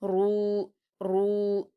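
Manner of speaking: रू said thick and heavy, drawn to two counts; teacher models the heavy ra with long u; heavy full ru. Shown as a demonstration. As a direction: repeat रू full-mouthed and long. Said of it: "पुर भी पढ़ेंगे".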